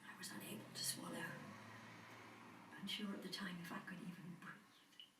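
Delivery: very soft, fading near-whisper; hushed shock. Acted. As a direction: barely get the words out. Recalling being unable to breathe.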